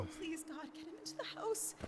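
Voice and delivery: soft, quick pleading prayer; hushed prayer. Acted. Wife struggling to move husband, hushed prayer. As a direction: plead quietly under your breath. Wife prays while lifting him.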